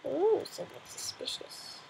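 hushed suspicious whisper; playful conspiratorial whisper. Acted. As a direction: breathe it like you're sneaking up. Sneaking up on something in game.

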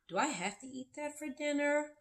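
questioning. Shown as a demonstration. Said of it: "Your question could be".

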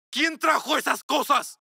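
outraged at the wedding things; angry demand. Acted. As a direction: confront the family. Angry son confronts household.

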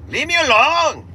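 put-on loud, indignant Mexican Dracula accent. Irritated driver telling a passenger off, Mexican Dracula accent. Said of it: "I'm like a Mexican Dracula".

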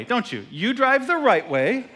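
ordinary talk, nothing marked

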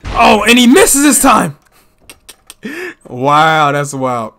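excited live play-by-play calling; wrestling commentator. Acted. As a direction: call each move as it lands.

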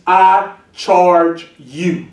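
each word drawn out slowly; preacher savoring I charge you; slow emphatic phrase. Shown as a demonstration. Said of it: "notice the phrase".

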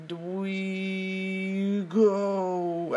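long high held "we go"; drawn-out leap cry. Pretend. Acting out a jump in a play movie, drawn-out high cry. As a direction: stretch it out high and very slow, like a playful jump.

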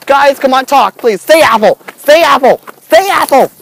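loud, insistent order; demanding shout. Real, not acted. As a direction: shout it at someone refusing to answer. Demanding that ducks speak.

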